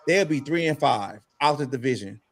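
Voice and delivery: ordinary delivery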